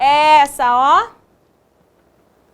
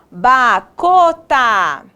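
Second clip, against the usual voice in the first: "Bacota" very slow, each syllable stretched; sounding out; much slower than usual. Shown as a demonstration. Teacher testing made-up word.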